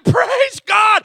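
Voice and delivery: loud, high burst of praise; excited shout. Retold or imitated. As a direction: cry out praise at full voice. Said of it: "I was shouting".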